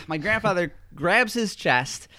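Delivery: ordinary talk, nothing marked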